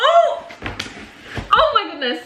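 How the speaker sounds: high sudden cry of surprise; delighted surprise. Real, not acted. Opening a publisher's package, a high yelp of surprise.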